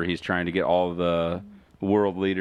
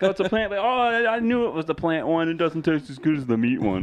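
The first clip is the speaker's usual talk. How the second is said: smug know-it-all customer; 10 semitones higher than usual. Retold or imitated. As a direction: pretend you knew it all along.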